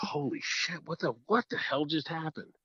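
hushed, breathy disbelief; shocked whisper. Retold or imitated. Boy watching deer run off, in a stunned whisper. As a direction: whisper it, stunned by what just happened.

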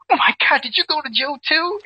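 imitating a fiancée opening her wedding gift, overjoyed; shocked delight. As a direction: squeal it like you can't believe the gift. Wife opens her gift, shocked.

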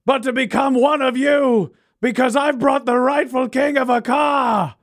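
slow, high rallying voice; grand proclamation. Acted. Reginald presenting the rightful king, grand proclamation.

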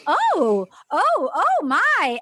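drawn-out, swooping mock-scandalized oohs; scandalized reaction. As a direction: play a shocked onlooker, slow and high. Acted scandalized woman's reaction.

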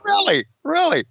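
high squeaky really; mock disbelief. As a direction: feign shock, way up high.

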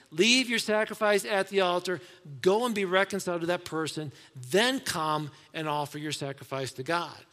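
loud, urgent reading of the verses; preacher reading scripture with rising force. Acted. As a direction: proclaim the passage to the church. Reads the passage aloud.

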